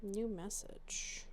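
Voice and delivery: slow, breathless whisper. Real, not acted. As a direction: whisper the notification under your breath. Reading phone notification.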